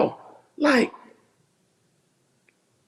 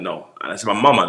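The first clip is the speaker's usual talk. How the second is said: usual speaking voice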